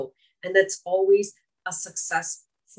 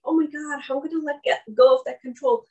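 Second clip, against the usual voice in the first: mildly alarmed, higher pitch; light mock dismay, raised voice; worried parent; 6 semitones higher, faster than usual. Voicing a parent's worry about control.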